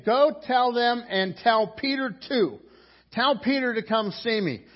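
high, loud, emphatic command; urgent summons. Acted. Angel sending the women for Peter, emphatic summons.

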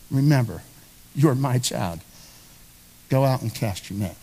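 low, quiet and steady; a calm firm father answering; voice of God. Acted. God gently answering his child, low and soft.

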